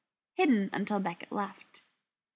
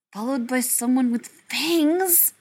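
higher, louder, drawn-out disbelieving question; indignant hiss; 4 semitones higher, 7 dB louder, slower than usual. A narrator's reading. Ryan to the vampire's friend on the phone, hissed indignantly. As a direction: hiss it in mild outrage, stretching the words. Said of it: "Ryan hissed".

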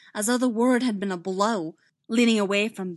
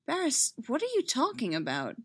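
higher-pitched baffled question; confused, raised-pitch query; bewildered; 5 semitones higher than usual. A narrator's reading. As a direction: ask it utterly puzzled.

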